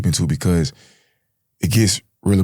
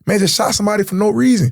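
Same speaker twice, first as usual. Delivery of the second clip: rising, higher, pressing the driver to go; panicked urging to flee; urgent plea; 9 semitones higher than usual. Retold or imitated. Telling the getaway driver to leave, urgent high plea. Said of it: "we're telling the driver".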